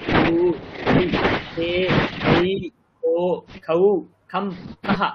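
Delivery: each consonant run through its vowel signs, one syllable at a time with short pauses; syllable drill recital. Shown as a demonstration. Teacher modelling the syllable order for students, careful recital. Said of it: "আপনি ক্রমটা বুঝে নিন".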